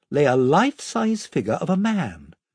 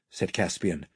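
ordinary delivery, nothing marked

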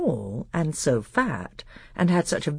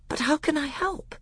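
put-on high-pitched young prince; high, eager question; earnest boy. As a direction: ask keenly in a boyish voice. Boy eagerly asks to help.

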